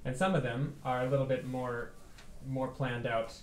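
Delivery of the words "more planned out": normal talking voice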